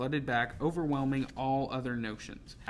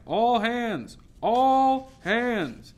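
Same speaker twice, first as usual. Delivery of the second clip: high, loud drawn-out call; urgent ringing shout; crying out; 10 semitones higher, 8 dB louder, much slower than usual. A narrator's reading. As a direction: call all hands across the ship. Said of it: "the bell clanging and the cry".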